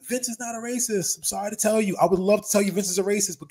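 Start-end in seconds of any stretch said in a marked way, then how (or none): 0.0-1.2 mock outrage. Mocking people who call Vince racist.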